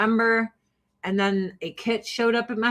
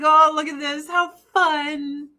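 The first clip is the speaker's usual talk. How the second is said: higher, sing-song fake excitement; mock delight; 7 semitones higher than usual. Someone reading the horoscope, higher mock delight. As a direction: coo over it like a giddy reader. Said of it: "And you're like".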